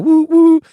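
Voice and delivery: very high, loud whistle woo woo; train whistle woo. Retold or imitated. Kid playing trains, shrill loud whistle. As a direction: hoot it like a toy train.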